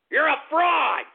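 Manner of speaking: angry shout. Real, not acted. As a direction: yell it right at him. Insulting Bernie.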